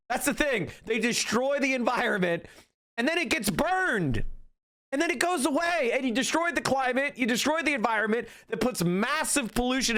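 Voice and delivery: high, heated rant; rising, worked-up exasperation; exasperated rant. Real, not acted. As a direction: pitch climbing in real frustration. Angry rant about pollution.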